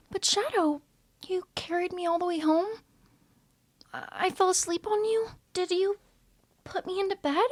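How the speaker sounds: high, soft and shy; a small, unsure voice; timid Cookie. Acted. Cookie asking Shadow how long he slept, high soft timid voice.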